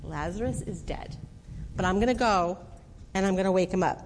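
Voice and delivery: low, soft, plain-spoken resignation; low and weary; weary sigh. Retold or imitated. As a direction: break it to them gently with a sigh. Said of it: "Jesus took a sigh right now and said".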